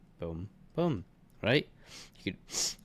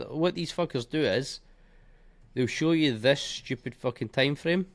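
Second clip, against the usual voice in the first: ordinary talk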